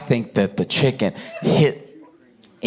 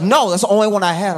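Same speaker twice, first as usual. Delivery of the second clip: indignant retort; 6 semitones higher, faster than usual. Retold or imitated. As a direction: snap back at the seatmate. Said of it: "He's like".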